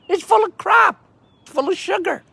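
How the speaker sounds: high, loud disgusted jab; indignant outburst. Real, not acted. Railing against sugar drinks, high and loud. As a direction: spit it out in disgust.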